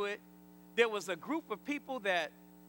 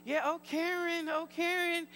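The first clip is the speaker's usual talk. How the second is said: higher-pitched chorus of casual greeters; everybody greeting her; 5 semitones higher than usual. Retold or imitated. Preacher imitates church members greeting the sister, high sing-out. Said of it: "And everybody".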